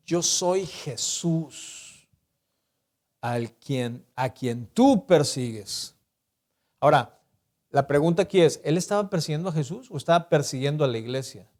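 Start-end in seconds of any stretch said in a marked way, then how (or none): 0.1-5.9 low, soft and slow; voice of Jesus. Acted. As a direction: say it gravely and quietly, like a solemn revelation. Preacher voices Jesus answering Saul.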